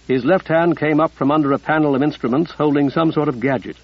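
ordinary delivery

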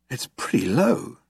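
slow, drawn-out understated amazement; impressed, slight whistle. A narrator's reading. Zaphod reads the improbability figures, slowly impressed. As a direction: let it out slowly, mildly impressed. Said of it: "continued Zaphod with a slight whistle".

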